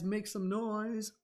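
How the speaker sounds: slow, high, drawn-out call; hype call. Real, not acted. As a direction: stretch it out to rally the crowd. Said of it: "let's make some more wolf howls".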